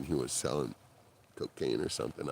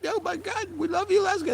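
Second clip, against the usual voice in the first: excited; 4 dB louder than usual. Retold or imitated. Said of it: "And he goes".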